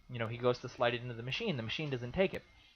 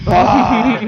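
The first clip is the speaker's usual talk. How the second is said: very loud, high mock scream; frustrated yell; 9 semitones higher, 19 dB louder than usual. Imitating a voter fuming over his ballot, frustrated yell. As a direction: imitate someone exploding in frustration. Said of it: "huffing and puffing".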